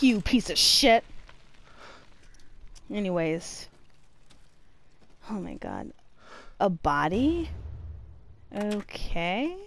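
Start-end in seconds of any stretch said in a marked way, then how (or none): none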